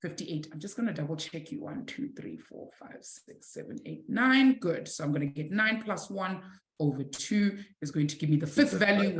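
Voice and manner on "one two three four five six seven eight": very soft, voiceless count under the breath; whispered counting. Real, not acted. Teacher double-checking an answer to herself, whispered count. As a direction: count along to yourself in a whisper.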